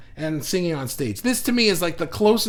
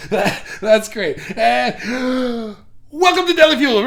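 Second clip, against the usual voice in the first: words squeezed through real laughter, rising higher and louder; laughing; 7 semitones higher, 7 dB louder than usual. Real, not acted. Streamer cracks up at Mikey's comment, laughing loud and high.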